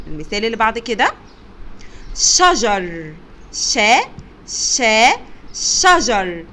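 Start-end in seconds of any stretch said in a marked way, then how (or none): 2.2-6.5 loud شجر, then شا broken out twice, then شجر; sounding out. Shown as a demonstration. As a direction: model the opening شا inside شجر. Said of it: "انطق وارسم دائرة".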